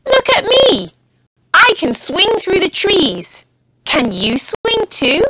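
high, bouncy show-off monkey; high boastful monkey voice. A narrator's reading. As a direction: brag like a cheeky monkey swinging about. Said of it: "Monkey says".

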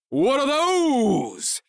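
very high, stretched-out mock shout; shouted meme voice. A narrator's reading. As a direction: yell it slowly like an over-the-top meme. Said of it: "shouted at Jordan in his best meme voice".

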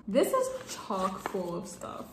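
ordinary talk

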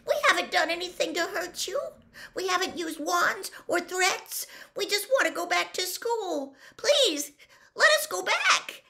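high, desperate, urgent begging; tearful pleading. A narrator's reading. Hermione begs to return to school, tearful and high. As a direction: beg in a high voice, building to a cry. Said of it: "real tears sliding down her face".

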